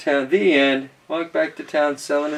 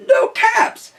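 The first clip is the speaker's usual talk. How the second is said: very high, loud cry; squeaky-high exclamation; 5 dB louder than usual. Acted. Storyteller finds nothing behind him, very high exclamation. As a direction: jump to the top of your range. Narrator acts out peddler's searches.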